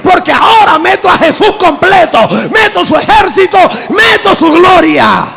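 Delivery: loud, high fiery preaching; full-force preaching. Acted. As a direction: preach it to the rafters.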